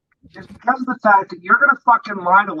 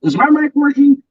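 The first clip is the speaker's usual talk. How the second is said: mock echo of Tim; 5 semitones higher, 5 dB louder than usual. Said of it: "Like Tim said".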